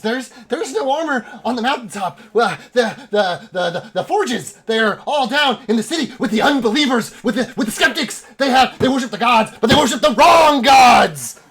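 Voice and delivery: high, loud rant building to a shout; stammer, then rail like a crazed zealot; frantic prophet's tirade; insane elf. Acted. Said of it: "fucking insane elf".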